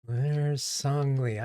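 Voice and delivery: ordinary delivery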